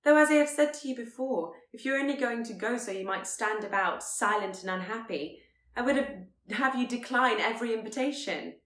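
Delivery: exasperated scolding. Acted. Elizabeth scolding Darcy.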